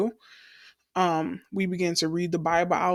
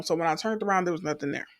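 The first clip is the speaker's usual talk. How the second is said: normal talking voice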